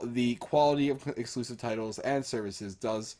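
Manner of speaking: ordinary talk, nothing marked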